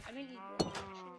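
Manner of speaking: ordinary talk, nothing marked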